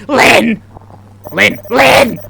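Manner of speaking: loud, high name call. Acted. Acted calling of a name.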